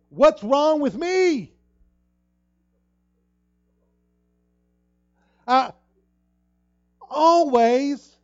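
high, slow, drawn-out anguished wail; despairing cry. Retold or imitated. Preacher acting out shame's voice, slow anguished wail. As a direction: stretch every word like a broken cry. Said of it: "You see how that, that thing?".

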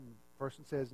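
usual speaking voice

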